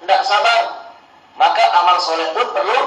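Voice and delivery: ordinary delivery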